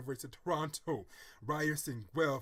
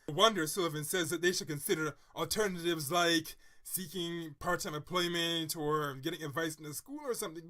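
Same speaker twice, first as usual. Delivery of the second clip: usual speaking voice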